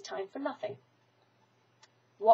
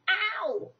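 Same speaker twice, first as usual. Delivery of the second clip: bear's yelp; 9 semitones higher than usual. A narrator's reading. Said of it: "and mostly".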